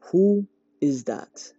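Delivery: slow, gruff man's voice from inside; deep voice. A narrator's reading. Uncle calling from inside the house, slow and gruff. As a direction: challenge the visitors slowly. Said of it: "a deep voice said".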